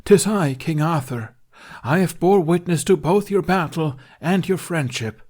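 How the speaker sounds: high, loud storybook proclamation; loud stage narration. A narrator's reading. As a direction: drown them out with grand narration. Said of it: "she shouted over Jack's laughter".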